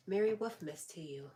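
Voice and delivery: ordinary talk, nothing marked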